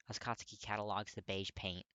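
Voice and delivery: ordinary delivery, nothing marked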